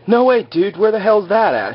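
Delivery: high, excited dude voice; mock amazement. Hurley hears of the manifest, high mock amazement.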